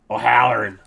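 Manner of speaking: O'Halloran in a loud, hammy Irish accent; a bad Irish accent, yelled like a drunk at the bar. Shown as a demonstration. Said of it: "I don't have a good Irish accent".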